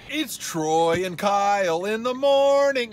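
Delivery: slow morning-show DJ intro; radio host voice. Pretend.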